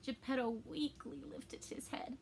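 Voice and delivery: faint unvoiced whisper; weak whisper. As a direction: whisper it weakly, worn out. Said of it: "Geppetto sounds really discouraged".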